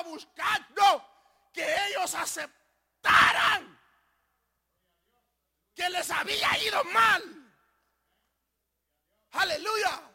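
high, shouted preaching; loud, emphatic pulpit shout; full-force preaching. Real, not acted. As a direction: thunder it slowly at the congregation.

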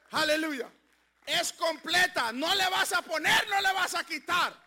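loud, slow, rising sermon cry; full-force Pentecostal preaching; fiery preaching. Acted. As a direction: preach it out to the congregation.

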